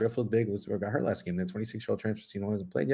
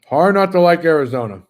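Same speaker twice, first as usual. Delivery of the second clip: emphatic declaration; 3 semitones higher, 9 dB louder than usual. Real, not acted. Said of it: "Hard not to like Zona".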